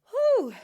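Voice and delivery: high loud gasp of relief; relieved whew. Real, not acted. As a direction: blow out after holding breath. Out of air after breath hold.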